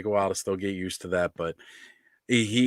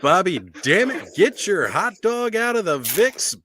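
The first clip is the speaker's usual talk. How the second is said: announcer voice; 8 semitones higher, slower than usual. Acted. Hammy announcer call.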